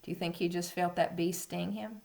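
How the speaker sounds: ordinary delivery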